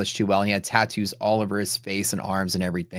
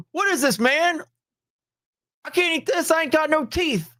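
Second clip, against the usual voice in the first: much higher, mock toothless old man; toothless homeless man. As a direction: play a gummy, indignant stranger who can't chew nuts. Toothless homeless man demanding food.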